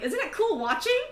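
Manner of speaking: very high, loud excited voice; high-pitched excited chirp; Raquel. Acted. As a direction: pipe up like a thrilled spectator. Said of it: "Raquel's like".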